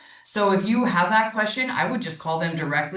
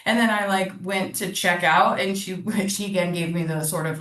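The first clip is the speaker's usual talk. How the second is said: ordinary talk, nothing marked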